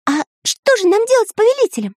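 very high, quick cartoon voice; little character's question. A narrator's reading. Pencil asks friends about the captive, high puzzled voice. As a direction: ask in a tiny high-pitched voice. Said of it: "спросил Карандаш".

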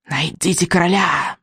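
slow, drawn-out witch's command; insistent witch. Acted. Witch pressing vikings to find the king, insistent. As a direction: press the order slowly, like a witch. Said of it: "гнула своё ведьма".

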